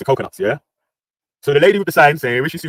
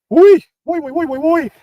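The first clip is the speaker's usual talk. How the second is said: mock squeal; slower than usual. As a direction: squeal it up high like a toy. Mocking squeal during stream talk.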